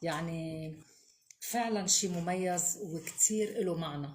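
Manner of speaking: ordinary talk, nothing marked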